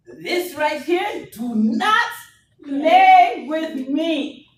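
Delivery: slow, drawn-out warning, pitched high; mock-stern warning. Real, not acted. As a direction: stretch each word out as a playful threat.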